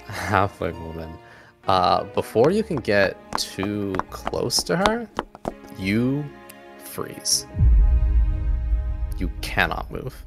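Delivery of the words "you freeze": normal talking voice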